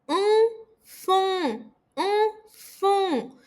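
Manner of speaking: um fum com som nasal, vibrando no nariz; faça o "um fum" ressoar no nariz. Shown as a demonstration. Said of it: "Coloca o dedo aqui no nariz e sente". (um fum bem nasal)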